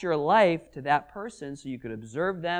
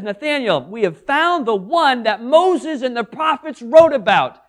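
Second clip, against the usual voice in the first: higher and louder, eager telling; eager news; 5 semitones higher, 6 dB louder than usual. Acted. Philip calling to Nathanael, eager and excited. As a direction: share the find with rising excitement. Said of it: "when Philip says".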